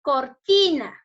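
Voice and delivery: "cortina" loud, with ti punched; loud teacherly emphasis on ti in "cortina"; stress on TI. Shown as a demonstration. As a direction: say "cortina" as cor-TI-na, middle syllable strong. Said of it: "dónde debería llevar la mayor fuerza de voz".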